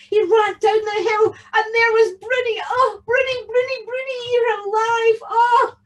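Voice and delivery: high, breathless rush of joy; overjoyed. A narrator's reading. Tam finds his cow alive, overjoyed. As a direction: pour it out loud and elated. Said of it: "he was overjoyed".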